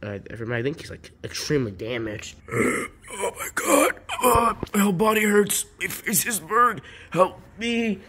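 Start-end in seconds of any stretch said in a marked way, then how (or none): 2.4-3.1 sharp hurt gasp; pained gasp. Acted. Burned puppet gasps at the hospital. 3.1-8.0 high, strained cries of pain; panicked pleading. Acted. Burned Superstar begging the hospital for help.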